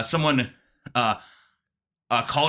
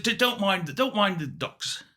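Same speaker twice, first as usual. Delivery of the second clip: put-on higher, stammering British old man; Britishy accent; 6 semitones higher, 3 dB quieter, slower than usual. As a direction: dismiss it as a fussy British caller. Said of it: "Britishy accent".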